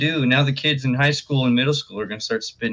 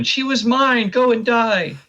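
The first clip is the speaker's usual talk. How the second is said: high mock fan voice; 11 semitones higher than usual. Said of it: "all the kids going".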